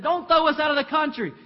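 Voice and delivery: pleading demons. Acted.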